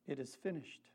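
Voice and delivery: "It is finished" spoken very softly and low; hushed repeat. Shown as a demonstration. Preacher repeats the last words, soft and solemn. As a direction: let the words fall to a near hush. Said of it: "That's what Jesus was referring to".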